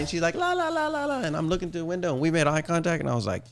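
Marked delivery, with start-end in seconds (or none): 0.4-1.2 high, airy la-la-la, playing the sweet girlfriend; mock polite sing-song. Man imitating his girlfriend's overly polite chatter. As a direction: trill it up high like a polite hostess. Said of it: "She's all being polite".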